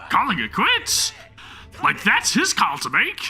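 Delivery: high, slow squeaky boss voice, badly hammed; horrible squeaky voice. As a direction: squeak it out like an outraged boss in a cartoon. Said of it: "I don't know why I'm using that voice. It's horrible".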